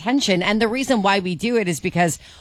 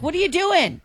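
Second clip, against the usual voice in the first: exasperated mom; 9 semitones higher than usual. Retold or imitated. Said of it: "I'm like".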